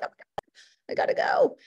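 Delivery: high squeaky quote of a rushing viewer; mock hurried viewer. Webinar host mimics viewers rushing off, high mock panic. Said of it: "people are like".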